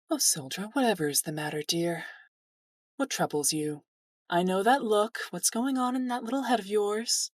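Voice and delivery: lightly voiced doting character with a smile; smiling. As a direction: ask with fond, lilting concern. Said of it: "She puts on a smile. She says".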